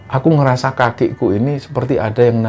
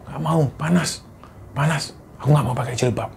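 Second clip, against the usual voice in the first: put-on possessed girl's voice; 4 semitones higher, 5 dB quieter than usual. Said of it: "bukan suaranya dia".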